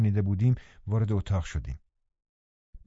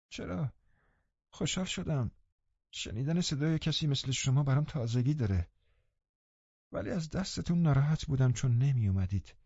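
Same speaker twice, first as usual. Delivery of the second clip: high, soft, weak invalid; frail sickly boy; 5 semitones higher, 6 dB quieter than usual. A narrator's reading. Linton answering Catherine, high and frail. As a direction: answer faintly, a little hurt.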